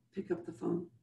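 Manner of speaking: whispered urging; soft insistent hush. Real, not acted. Coach urging listeners.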